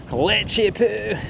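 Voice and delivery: slow, high Scottish-accented Lechy Poo. Shown as a demonstration. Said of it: "I like to do it in a Scottish accent".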